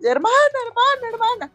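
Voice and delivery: high pleading calls; girl calling her lost sister. Acted.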